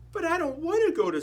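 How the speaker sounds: put-on high little-girl tortoise voice; young Shelley. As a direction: plead like a small child.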